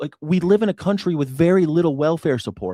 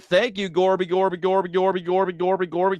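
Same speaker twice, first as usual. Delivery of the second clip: high, mocking sycophant voice; mock fawning; 6 semitones higher than usual. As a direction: ham up the gushing praise. Mock fawning praise of clip.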